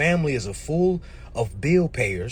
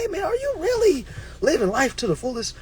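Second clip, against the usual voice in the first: high questioner; 11 semitones higher than usual. Acted. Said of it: "Somebody really sat you down and say".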